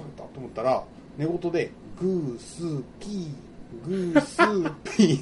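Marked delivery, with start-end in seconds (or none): 2.0-5.2 slow, drowsy mock snore sounds; sleep talking. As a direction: imitate a child's sleepy snoring words. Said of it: "って言って寝たんよ".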